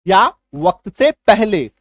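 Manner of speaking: meaning drawn out slowly; slow teacher gloss; slow explanation. Shown as a demonstration. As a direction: stretch each word for the class. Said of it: "शीघ्र".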